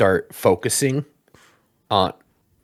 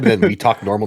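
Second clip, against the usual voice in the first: ordinary talk, nothing marked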